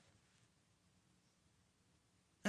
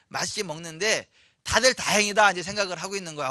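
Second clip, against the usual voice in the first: ordinary delivery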